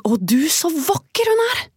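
high amazed dwarf voices; amazed calling. Acted. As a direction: gasp out the wonder high.

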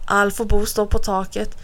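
ordinary delivery, nothing marked

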